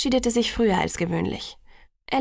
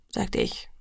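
plain reading voice

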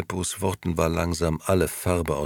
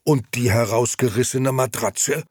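put-on higher, louder, worked-up old man; agitated; 7 semitones higher, 4 dB louder than usual. Old Beppo confronting Gigi about the tyre tracks, agitated. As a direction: throw the question back at him. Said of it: "fragte Beppo aufgebracht".

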